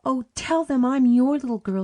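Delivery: high, loud desperate plea; girl begging the Pretty Lady to claim her. Acted. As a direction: beg her with all your heart. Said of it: "she begged".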